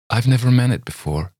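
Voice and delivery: mean every word; earnest. A narrator's reading. Girl assures Campbell she is sincere, earnest. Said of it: "she said quite earnestly".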